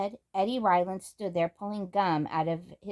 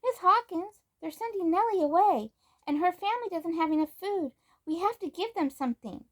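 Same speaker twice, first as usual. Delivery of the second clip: much higher, urgent rushed plea; urgent, breathless; 11 semitones higher than usual. Acted. Samantha begs Miss Hawkins for help, high urgent plea. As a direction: blurt it all out, out of breath. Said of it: "Samantha cried breathlessly".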